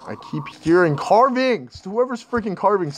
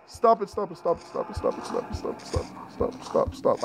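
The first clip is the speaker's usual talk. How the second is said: breathless unvoiced stop it over and over; panicked whispered pleading; 10 dB quieter than usual. Real, not acted. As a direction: beg the monster in a whisper. Panicked pleading during scare.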